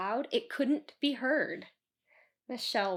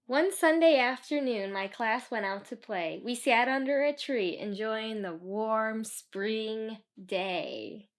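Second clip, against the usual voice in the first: usual speaking voice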